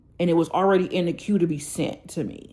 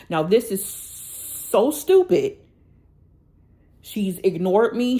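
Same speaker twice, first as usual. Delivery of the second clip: slow and louder, every word leaned on; fed-up anger; 5 dB louder, slower than usual. Real, not acted. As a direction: spell out how stupid this is, slowly. Venting anger at the seller.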